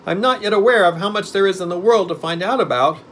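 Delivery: high, loud put-on creature voice; pumpkinhead. A narrator's reading. Newborn pumpkin man pondering his own wisdom, high and loud. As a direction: ponder aloud in a high, earnest pitch. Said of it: "replied Jack".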